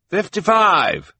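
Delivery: high, loud bid called across the room; calling out a bid. A narrator's reading. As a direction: shout the bid out. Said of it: "Jerome called out".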